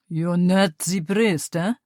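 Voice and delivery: slow, mocking Russian-accented question; Russian officer mocking the priest with a sneer. A narrator's reading. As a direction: drag it out with a sneer. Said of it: "the man asked with a sneer".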